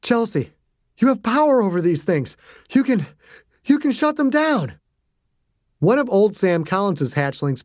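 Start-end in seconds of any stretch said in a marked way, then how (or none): 0.0-4.7 high, panting and urgent; father urging his daughter, out of breath; breathless urging. A narrator's reading. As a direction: push the words out between breaths. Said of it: "breathing like he'd just come back from a run".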